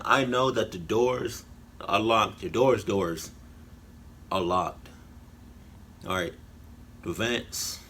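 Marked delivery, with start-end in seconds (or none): none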